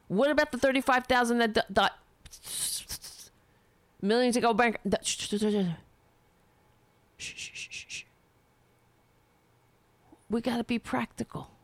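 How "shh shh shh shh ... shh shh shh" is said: mock shushing. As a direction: shush yourself like an establishment hall monitor. Mock shushing cuts him off.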